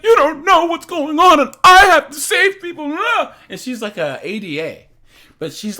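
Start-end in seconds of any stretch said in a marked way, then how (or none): 0.0-3.0 high, loud hammed-up outburst; over-the-top mock dramatic yell; overacting. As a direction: shout it melodramatically, mocking a ham actress. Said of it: "She's overacting".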